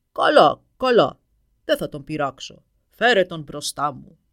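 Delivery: booming, slow giant voice; ogre roar. A narrator's reading. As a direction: roar like a hungry dragon. Dragon agrees. (βροντερή φωνή δράκου που βρυχάται)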